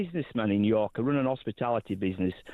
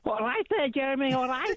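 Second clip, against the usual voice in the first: high-pitched, badly faked Scouse greeting; bad Scouse accent; 11 semitones higher than usual. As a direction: put on a terrible, squeaky-high Scouse accent. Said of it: "That's the worst accent I've ever heard".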